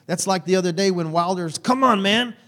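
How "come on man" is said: higher, half-joking frustrated call to his son; mock exasperated dad. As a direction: imitate yourself losing patience with the kid. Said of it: "That's like me getting mad".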